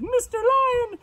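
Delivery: very high, loud piggy squeal; pleading pig. Acted. Pig hands the mane back to lion, high and pleading. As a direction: beg in an urgent squeal.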